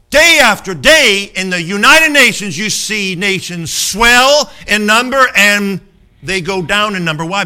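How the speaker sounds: a preacher hammering home his point to the congregation, loud and climbing; full-force preaching. Acted. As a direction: thunder it from the pulpit, building as you go. Preaching the constitution comparison.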